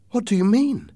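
high-pitched mother's question; mother's voice, lifted high; worried mother. Acted. Alarmed mother questions her son.

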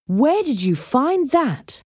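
high, slow demand in disbelief; indignant astonishment, stretched out; incredulous. Acted. Jake sees the treasure he wanted, incredulous.

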